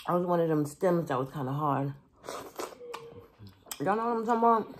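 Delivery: talking while eating; chewing between words; mouth full chewing. Real, not acted. Seen 1: Food goes in at 1.9; mouth full and chewing through 6.1.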